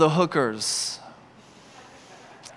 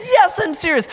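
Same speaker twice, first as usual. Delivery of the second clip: eager insistence; 12 semitones higher, 3 dB louder than usual. Retold or imitated. Retold eager reassurance.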